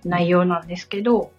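ordinary delivery

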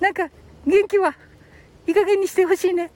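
high-pitched mock complaint; wife's gripe in a high puppet voice; complaining puppet. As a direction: grumble as a little high dummy.

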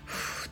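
ふっ blown through rounded lips like blowing out a candle; ふっ as pure escaping breath; blown Japanese fu. Shown as a demonstration. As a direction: say ふっ as a voiceless puff of air. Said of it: "ろうそくを吹き消す時の口".

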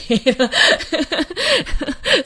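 a bright surprised laugh; burst of laughter. Real, not acted. Host laughs at her own slip. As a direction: laugh out loud at yourself.

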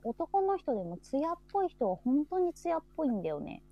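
normal talking voice

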